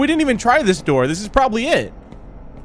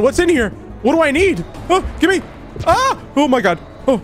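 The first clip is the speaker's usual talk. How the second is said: frantic; 8 semitones higher than usual. Real, not acted. Frantic searching while chased.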